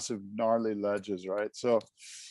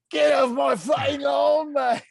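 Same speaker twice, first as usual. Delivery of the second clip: loud, high-pitched shout in a rough attempt at a Scottish accent; 12 semitones higher, 7 dB louder than usual. Imitating a cartoon groundskeeper for a podcast co-host, shouted bad Scottish accent. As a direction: holler it like an irate Scottish groundskeeper. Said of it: "however that Scottish accent goes".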